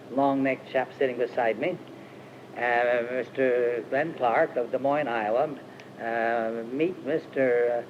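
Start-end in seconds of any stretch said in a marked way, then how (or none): none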